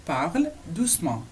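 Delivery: "Parle doucement" slowly, with a break after "Parle"; word by word. Shown as a demonstration. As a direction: separate the two words for learners. Teacher separates the two words.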